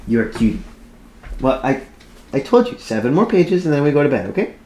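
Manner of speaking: high, cooing sing-song to a puppy. Real, not acted. Talks to her dog mid-reading.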